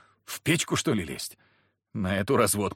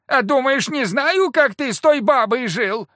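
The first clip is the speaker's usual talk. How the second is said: high, loud woman's scolding; shrill retort; 13 semitones higher, 7 dB louder than usual. A narrator's reading. Wife shouts back at her husband, high and loud.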